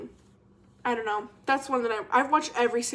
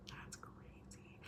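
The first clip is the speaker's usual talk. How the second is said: breathy aside whisper, slow; 29 dB quieter, much slower than usual. Real, not acted. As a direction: let it slip out as a secret aside.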